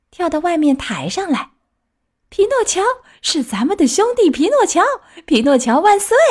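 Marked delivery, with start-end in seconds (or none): 2.3-6.2 high-pitched rousing cheer; joyful shouting. A narrator's reading. All the puppets hail their brother Pinocchio, joyful high shouting. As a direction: cheer like a troupe of puppets crying out. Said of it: "所有的木偶同声大叫".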